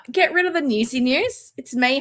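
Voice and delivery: ordinary talk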